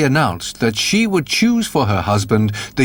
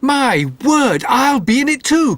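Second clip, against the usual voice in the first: much higher, bursting with excitement; a naive lad jumping at the chance; eager cry; 10 semitones higher than usual. A narrator's reading. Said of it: "cried Jack the Dullard".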